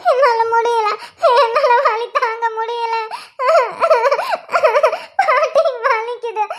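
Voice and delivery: slow, drawn-out wailing words; sobbing in pain. Acted. Woman in labour tells grandmother she can't bear it, wailing slowly. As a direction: drag the words out through sobs. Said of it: "வயிறு வலிக்குதுன்னு கத்திட்டு இருக்கா".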